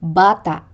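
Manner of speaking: Bata with stressed Ba-, abrupt catch after the final a; penultimate glottal stress. Shown as a demonstration. Teacher showing glottal catch, Bata stressed on first syllable. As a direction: stress the start of Bata, cut the end off. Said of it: "pronounced with a glottal catch".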